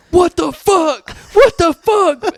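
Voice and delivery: high, loud confused shout; baffled yelling. Retold or imitated. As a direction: yell it in disbelief. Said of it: "this guy's just going".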